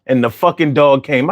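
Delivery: usual speaking voice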